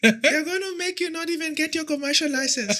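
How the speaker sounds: high-pitched South African girl impression; mock South African accent, high and bright. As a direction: put on a broad South African accent, teasing. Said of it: "I'll try put the accent".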